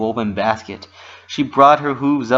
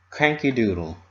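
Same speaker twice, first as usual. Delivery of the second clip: usual speaking voice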